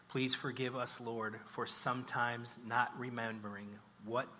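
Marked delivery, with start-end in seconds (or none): none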